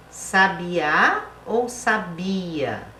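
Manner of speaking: "sabiá" on the final Á, then "sabia" on BI, very slowly; very slowly set sa-bi-Á against sa-BI-a; very slow classroom contrast of "sabiá" and "sabia"; contrasting stress. Shown as a demonstration. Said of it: "Quando a palavra é acentuada".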